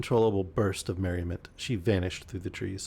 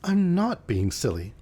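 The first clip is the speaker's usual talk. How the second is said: annoyed; 7 semitones higher, 4 dB louder than usual. A narrator's reading. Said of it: "I was a little annoyed".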